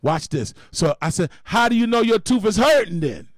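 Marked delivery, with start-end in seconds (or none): 1.4-3.3 louder, much higher, pushing hard; pressing challenge. Retold or imitated. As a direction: get in his face with it. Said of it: "I put the same questions back".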